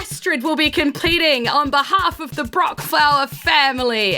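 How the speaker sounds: high, loud, slow announcing; proud host. Acted. Host presents his family's champion, grandly. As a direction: boast to the crowd. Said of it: "And he's like".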